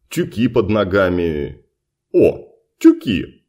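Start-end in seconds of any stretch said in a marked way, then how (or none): 2.1-3.4 excited. A narrator's reading. Sudden discovery.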